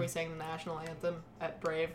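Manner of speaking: ordinary talk, nothing marked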